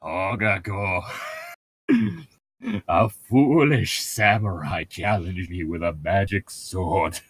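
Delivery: mock cartoon villain intro; Aku intro. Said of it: "Samurai Jack".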